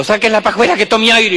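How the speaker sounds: a busybody taking charge; bossy bystander. Acted.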